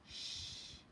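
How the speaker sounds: soft breathy whisper; whispered shush. Acted. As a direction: hush them without waking Daddy. The baby hushes the others.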